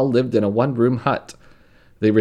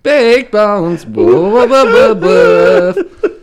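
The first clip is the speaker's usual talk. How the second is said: loud, much higher, yapping woofs; mock dog barks; 14 semitones higher, 11 dB louder, much slower than usual. Host closing the ad with silly loud dog barks. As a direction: bark the sign-off like an excited little dog. Ad sign-off with barking.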